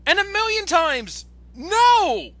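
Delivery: high, loud outraged refusal; emphatic shouted no. Real, not acted. Rejecting the Michael Bay comparison.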